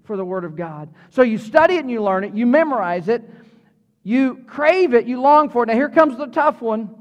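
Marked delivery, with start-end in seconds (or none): 4.0-5.7 emphatic crave. Shown as a demonstration. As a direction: stretch out and punch crave. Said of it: "contrasting takes of crave it".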